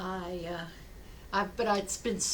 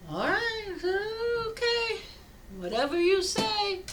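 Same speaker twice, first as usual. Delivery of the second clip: very high, sing-song acted compliance; doubtful agreement; 11 semitones higher than usual. Villager giving in to rabbi's advice, doubtful agreement. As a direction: go along with it in a high, dubious voice.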